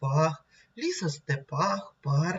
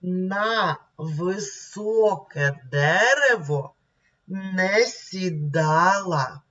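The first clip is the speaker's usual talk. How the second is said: slow, syllable by syllable reading; 2 semitones higher, much slower than usual. Shown as a demonstration. Teacher rereading the poem slowly for pupils to repeat. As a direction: read it slowly so children follow. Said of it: "І ще раз давайте прочитаємо".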